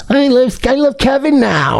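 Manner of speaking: high mocking lovestruck voice; mock gushing. Streamer quoting his ex about Kevin, mock gushing. Mocks ex gushing over new man.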